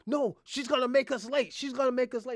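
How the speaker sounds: wife shouting she'll make them late. Retold or imitated.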